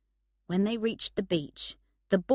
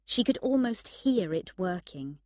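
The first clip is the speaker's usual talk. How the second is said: usual speaking voice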